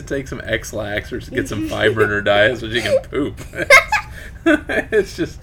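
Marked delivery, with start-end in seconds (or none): 3.5-4.4 high unvoiced giggle; high laugh. Real, not acted. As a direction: burst out laughing. Laughing at poop joke.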